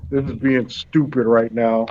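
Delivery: ordinary delivery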